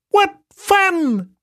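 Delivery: very high, cheeky monkey; squeaky monkey voice. Acted. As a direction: play a tiny chattering monkey. Monkey speaks in the story.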